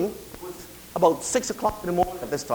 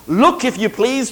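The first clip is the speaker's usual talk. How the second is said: ordinary talk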